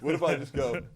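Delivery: usual speaking voice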